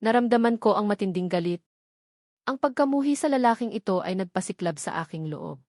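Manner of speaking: plain reading voice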